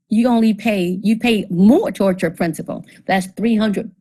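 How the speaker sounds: ordinary talk, nothing marked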